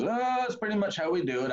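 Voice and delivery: high, casual mock groom; lightly voiced carefree groom.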